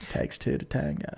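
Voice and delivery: whispered under the breath; whispered aside. Real, not acted. Comedian repeats his own punchline in a whisper.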